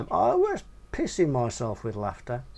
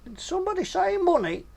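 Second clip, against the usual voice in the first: much higher, hammed-up caricature of a big greedy woman; Godzilla's voice; 12 semitones higher, 3 dB louder than usual. Big woman hearing money mentioned, hammed-up high voice. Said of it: "said Godzilla".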